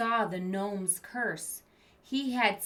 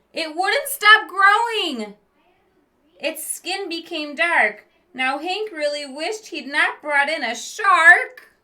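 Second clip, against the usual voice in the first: loud, high, building storybook reading; dramatic story narration; 9 semitones higher, 14 dB louder than usual. A narrator's reading. Reading a spooky tale to kids, loud and dramatic. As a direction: read it big to the kids.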